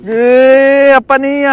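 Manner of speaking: loud, high exclamation; surprised shout. Real, not acted. Angler startled by what bites, surprised shout. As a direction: cry out, caught off guard.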